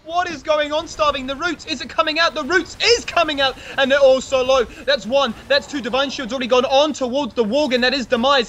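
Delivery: high, fast frantic commentary; calling a wild teamfight; live play-by-play. Real, not acted.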